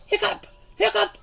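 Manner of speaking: monster hiccups. Acted.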